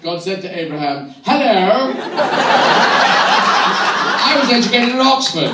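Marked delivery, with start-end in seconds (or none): 1.2-5.5 high, slow, plummy Oxford voice as God; God speaking like an Oxford graduate; posh God voice. Retold or imitated. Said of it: "Just like Richard the Lionheart".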